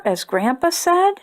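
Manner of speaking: usual speaking voice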